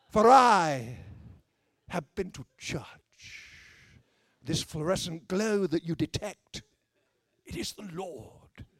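slow, grandiose holy-man parody; hammed-up solemn pronouncement, sinking to a hush at the end; mock-pious proclamation. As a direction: intone it grandly to the gathered followers. Parodies pompous proclaiming to workmates.

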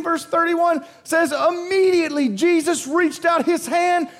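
high, loud climactic preaching; preaching at full force. Acted. Preacher rallies his congregation: loud, high climactic sermon. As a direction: drive it home to the congregation.

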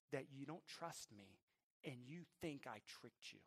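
very soft, imitated tearful child; tearful apology. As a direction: retell a son's hushed, hurt apology. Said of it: "through tears".

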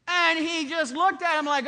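loud, high-pitched sermon voice; preaching at full force. Acted. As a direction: preach it out to the whole congregation. Preaches from John and Isaiah.

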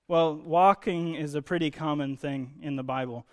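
ordinary delivery

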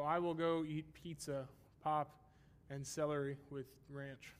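slow list with pauses between items; comma-separated list. Shown as a demonstration. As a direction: set each food apart with a pause after "pizza" and "pop". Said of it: "We just have commas".

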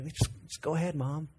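put-on teen urging mom on, softly embarrassed; hushed embarrassed plea. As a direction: beg mom quietly to walk ahead. Embarrassed teen asks quietly.

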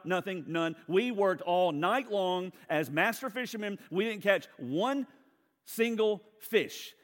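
mock indignant, Jesus, look around; exasperated Simon. Simon Peter arguing with Jesus about fishing, loud and sarcastic. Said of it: "very polite way of Simon Peter saying".

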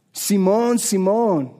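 hammy mock Mexican accent; Nacho Libre. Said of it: "That's my Nacho Libre right there".

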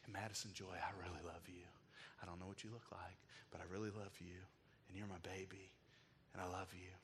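soft whisper full of love; tender whisper. Retold or imitated. Father whispering love to his unborn daughter. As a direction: breathe it gently to the unborn baby. Father speaking to baby in womb.

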